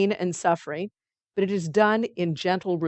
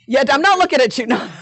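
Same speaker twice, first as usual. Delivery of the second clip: mock innocent denial; 5 semitones higher, 7 dB louder, faster than usual. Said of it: "And he says".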